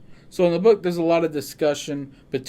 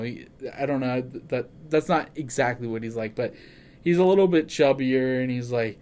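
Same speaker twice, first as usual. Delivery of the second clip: usual speaking voice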